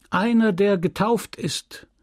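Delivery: put-on high child's recital; bright children's voices. A narrator's reading. Children answering the priest's catechism, high recited reply. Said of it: "die hellen Stimmen der Kinder".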